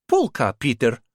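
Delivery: a playful put-on Russian accent, exclaimed; mock Russian accent. Martha joking to Kite about the radio polka, in a mock Russian accent. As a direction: tease him with a jokey Russian accent. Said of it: "exclaimed in a mock Russian accent".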